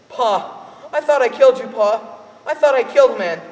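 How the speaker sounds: put-on high, loud, panicked boy; boy crying out to his father, panicked; frantic boy. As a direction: blurt it out in terror. Boy distraught, thinks he shot father.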